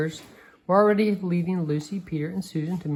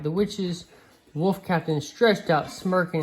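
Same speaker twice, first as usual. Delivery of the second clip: ordinary delivery, nothing marked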